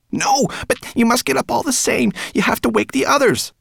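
high, loud insistent rat voice; urgent rat. A narrator's reading. Rat urging dragon to wake others, urgent. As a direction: press him urgently at high pitch.

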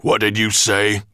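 loud growled challenge. A narrator's reading. Dragon overhearing his servant's grumble, growling loudly. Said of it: "growled Nettlebrand".

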